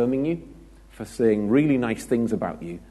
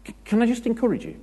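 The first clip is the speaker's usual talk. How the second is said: much higher, fast, sugary parody; mock encourager; 13 semitones higher, faster than usual. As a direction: ham up the gushing encourager even more. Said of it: "They start a sentence with that".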